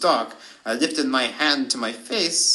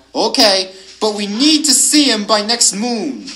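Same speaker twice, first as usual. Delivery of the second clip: higher, louder wolf reply; howled concession; 6 semitones higher, 5 dB louder than usual. A narrator's reading. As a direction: howl back a grudging agreement. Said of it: "the hooligan howled back".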